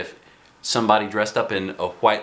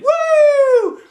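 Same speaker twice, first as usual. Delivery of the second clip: very high, loud whoop; cheering whoop; 9 dB louder than usual. Acted. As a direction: cheer like a crowd. Class cheering.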